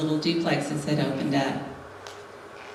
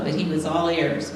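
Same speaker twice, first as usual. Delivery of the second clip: ordinary delivery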